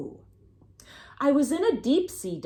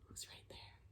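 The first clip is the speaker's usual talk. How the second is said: hushed whisper; 31 dB quieter than usual. Acted. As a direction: barely whisper it. Hushed question to kids.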